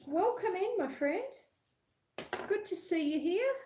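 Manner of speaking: high, bright welcome; cheery welcome. Real, not acted. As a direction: welcome them in with a lift. Greeting viewer on live stream.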